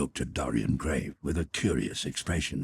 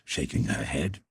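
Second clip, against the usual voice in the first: usual speaking voice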